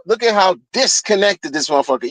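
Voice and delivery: usual speaking voice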